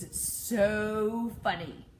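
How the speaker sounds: drawn-out sarcastic SO, dropping on funny. Real, not acted. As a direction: stretch SO with mock amusement. Sarcasm at trivializing OCD.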